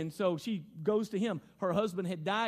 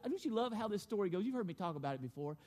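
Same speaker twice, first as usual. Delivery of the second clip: usual speaking voice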